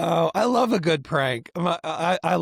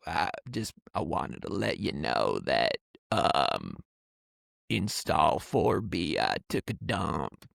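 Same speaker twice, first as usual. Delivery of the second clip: put-on much lower and softer, slow husky old woman; old lady smoker voice; 10 semitones lower, 5 dB quieter, slower than usual. Prank caller confessing to the trucker in smoker's voice. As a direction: drag the words out like a worn-out smoker. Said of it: "old lady smoker's voice".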